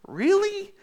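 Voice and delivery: shocked, questioning "Really?"; incredulous. Retold or imitated. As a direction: react as if you can't believe what you just read. Preacher acting out reader's disbelief.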